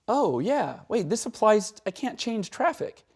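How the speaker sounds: catching himself in traffic, realizing he can't change it; sudden realization. Retold or imitated. Retold sudden realization in car.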